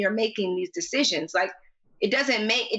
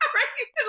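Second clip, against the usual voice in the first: high laugh. Real, not acted. Laughing at own reaction.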